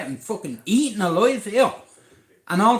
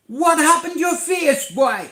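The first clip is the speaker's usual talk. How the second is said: retold shout; 7 semitones higher, 5 dB louder than usual. Neighbour questions his beaten face.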